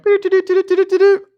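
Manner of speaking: high, loud beeping alarm; loud high doo-doo alarm noise. Retold or imitated. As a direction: beep like a radar going off. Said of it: "start going off".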